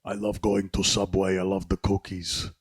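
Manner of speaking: mock Austrian accent; put-on, vaguely Austrian accent; Arnold. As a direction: attempt a foreign accent that lands as Austrian. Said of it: "That's Arnold".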